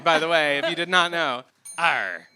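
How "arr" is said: pirate growl. Pretend. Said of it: "Talk Like a Pirate Day".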